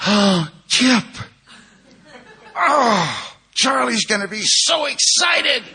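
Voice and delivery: high, loud, gushing delight; shrieky, drawn-out excitement; mock excited squeal. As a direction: imitate an overjoyed woman shouting her welcome. Voicing wife's delighted greeting at door.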